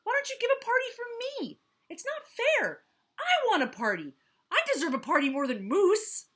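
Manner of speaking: high, loud, fast demand; march up and demand it. Acted. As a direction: Jackal demanding an answer from Zebra, high and loud. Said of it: "he demanded".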